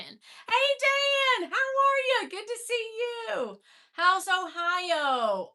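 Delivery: high, loud call-out to a friend; light up and call his name across the room; excited greeting. Real, not acted. Greeting a regular viewer on the livestream, high and loud.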